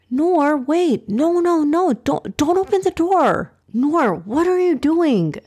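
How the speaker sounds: high-pitched alarmed protest; utterly confused. A narrator's reading. Ibrahim stopping Noor opening the door, alarmed confusion. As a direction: stop her urgently, voice pitched right up. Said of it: "Ibrahim asked in utter confusion".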